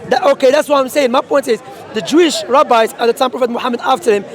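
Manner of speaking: usual speaking voice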